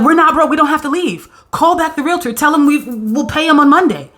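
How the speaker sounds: high, bursting pretend celebration; giddy relief. Reader voicing a saved family, high and giddy. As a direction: squeal out the good news like you're saved.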